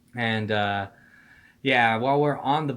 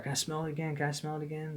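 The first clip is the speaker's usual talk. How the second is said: soft, higher-pitched pestering; imitated pleading; 3 semitones higher, 9 dB quieter, faster than usual. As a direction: quietly mimic someone asking over and over. Mimics seatmate's repeated asking.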